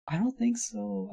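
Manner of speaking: high, slow skeptical drawl. Acted. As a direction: stretch it out, doubting. Doubting crime as money advice.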